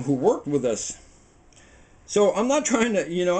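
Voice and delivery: ordinary talk, nothing marked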